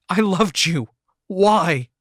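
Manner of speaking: high, loud betrayed cry; shout. Acted. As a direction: yell the word at her, wounded. Yelling at cheating fiancée.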